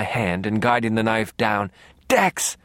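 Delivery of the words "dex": short, high cry; urgent call. A narrator's reading. Calling to him as the knife comes down, urgent. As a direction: cry the name out, high and sudden.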